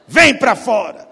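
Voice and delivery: calling Lazarus out of the tomb, loud and slow; commanding call. Pretend. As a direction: order the dead man out.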